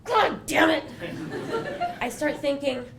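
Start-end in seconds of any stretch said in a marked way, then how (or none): 0.9-1.9 high laugh. Real, not acted. Laughing at the irony.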